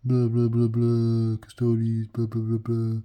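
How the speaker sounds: loud, droning boastful rival; hammed-up mocking blah-blah chant; mocking rival impression. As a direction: drone it out loudly, mocking a braggart. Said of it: "he's a big".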